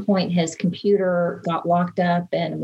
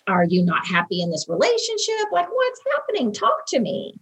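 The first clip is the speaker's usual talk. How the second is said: much higher, fast frustrated scolding; wife retelling how she scolded her distracted husband, exasperated. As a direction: pitch it up, fed up and demanding answers. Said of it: "I was full of anger".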